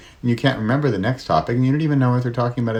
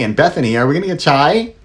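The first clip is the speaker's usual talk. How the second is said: put-on higher, louder eager kid voice; playful higher child voice, louder; imitating son; 8 semitones higher, 7 dB louder than usual. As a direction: mimic a hopeful child asking. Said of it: "That's what my son says".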